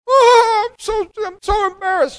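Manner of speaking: put-on very high, wailing little old lady voice; crying old lady. Elderly churchgoer realizing the pastor knows, high mock wail. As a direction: pastor imitates her high, weepy dismay. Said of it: "So she started crying".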